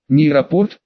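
ordinary delivery, nothing marked

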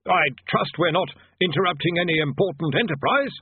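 a hint of a nervous polite wizard; polite bursar. A narrator's reading. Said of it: "said the bursar politely".

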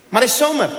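coy foreign cleaner in broken Dutch; Brazilian accent. Retold or imitated.

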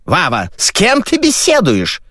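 high, cross motherly question; angry mother. Acted. Mother catching her son talking to someone, angry. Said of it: "рассердилась маменька".

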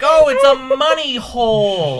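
nada mengejek yang dibuat-buat; mocking whine. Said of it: "I was like".

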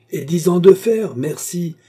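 loud, high sarcastic retort; exclaimed, mocking thanks; scornful refusal. A narrator's reading. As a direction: throw the bribe back indignantly. Guard scornfully rejects bribe.

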